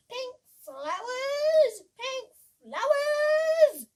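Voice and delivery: high, loud, slow buzzing bee voice; the striped bee buzzes its words. Acted. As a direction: buzz it out like a bee. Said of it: "must a big striped bee".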